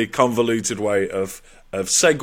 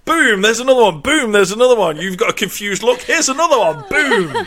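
higher, louder bursts of mock excitement; scattergun impression; 7 semitones higher, 3 dB louder than usual. Host mimics their scattergun questioning, high mock-excited bursts. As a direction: fire each one off like a scattergun. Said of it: "like a scattergun of just like".

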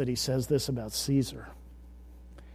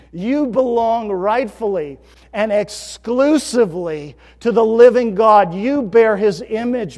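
loud, ringing sermon voice, slower on key lines; forceful preaching; 6 semitones higher, 15 dB louder than usual. Acted. Pastor preaching loudly to his congregation about bearing God's image, at full force. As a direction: preach it out to the whole room.

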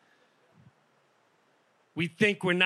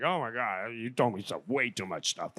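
low, soft deflated moan; deflated groan; 8 semitones lower, 8 dB quieter than usual.

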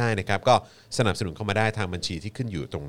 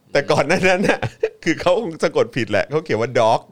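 loud, high, laughing through the words; tickled, laughter bubbling up in a raised voice; real laughing; 5 semitones higher, 6 dB louder than usual. Real, not acted. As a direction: tell it while cracking up. Father laughing at child's misspelling.

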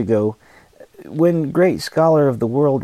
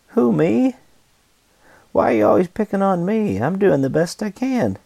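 defensive whine; 8 semitones higher than usual. Pretend. Sinner's defensive mock reply.

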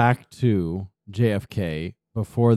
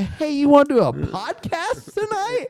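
high mock phone greeting. Mock phone call request.

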